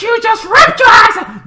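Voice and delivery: high, loud shouted accusation; hammed-up high yell; mock yell. Figure yells at him.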